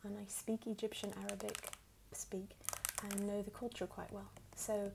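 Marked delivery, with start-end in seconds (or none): none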